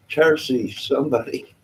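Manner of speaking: ordinary delivery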